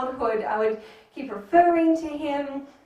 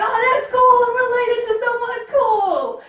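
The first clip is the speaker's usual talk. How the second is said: higher, louder delight; mock excited kid; 9 semitones higher, 6 dB louder than usual. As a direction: gush like a thrilled child. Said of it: "It's like".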